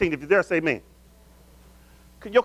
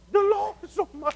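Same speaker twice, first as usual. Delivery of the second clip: awed realization; 11 semitones higher than usual. Acted. Acting out looking back in awe.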